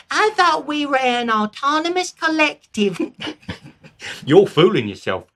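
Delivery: high, loud peasant slipping into Cockney; a Cockney yokel arguing; half-hearted Cockney; Cockney slip. Acted. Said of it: "I went into a cockney there for a second".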